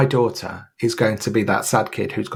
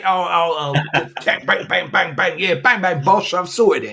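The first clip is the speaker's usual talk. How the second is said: put-on higher, brash wide-boy mechanic bravado; cocky mechanic Jackie; 7 semitones higher than usual. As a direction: play the chirpy know-it-all fixer. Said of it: "Oi, oi, oi, it's Jackie".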